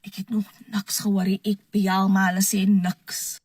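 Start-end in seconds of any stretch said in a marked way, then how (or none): none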